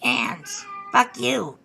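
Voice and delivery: playful pretend scolding from a pet; voicing the cat. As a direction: speak up as the cat's comic voice. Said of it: "even my cat is like".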